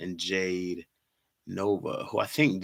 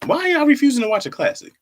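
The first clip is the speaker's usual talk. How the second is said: exasperated shout; 10 dB louder, faster than usual. Real, not acted. Exasperated at viewers.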